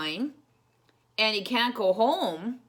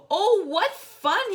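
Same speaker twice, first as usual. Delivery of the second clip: high, slow, louder cry of delight; 13 semitones higher, 9 dB louder, slower than usual. Acted. Corduroy sliding on soap flakes, delighted cry. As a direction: say it with a big smile. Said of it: "he said with a smile".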